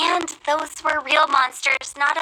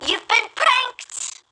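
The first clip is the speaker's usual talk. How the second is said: higher, slow, teasing sing-song; gleeful prank reveal; 8 semitones higher, much slower than usual. Acted. Prankster gloating to her fooled client, slow and teasing. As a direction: drag it out like a triumphant gotcha.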